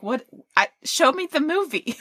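high, bewildered mock outburst; baffled. To co-host, retelling a confused search reaction, baffled. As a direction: play your own baffled reaction to the search.

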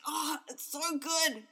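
high, loud, drawn-out gush; slow, delighted kid's praise, pitched high; excited child. Retold or imitated. Son praises mum's first recording, gushing with excitement. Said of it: "he was so excited".

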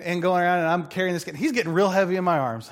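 ordinary delivery

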